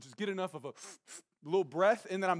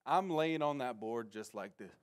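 ordinary talk

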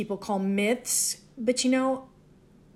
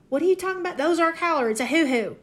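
higher, mock puzzled voice of an imagined local; mock baffled local; 7 semitones higher, much faster than usual. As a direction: play a bewildered local correcting someone, hammed-up. Imagined listener's puzzled retort.

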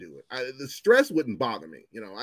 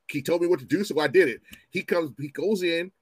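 ordinary talk, nothing marked

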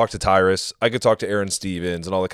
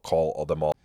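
usual speaking voice

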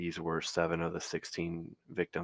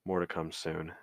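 usual speaking voice

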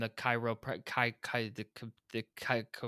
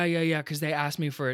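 ordinary talk

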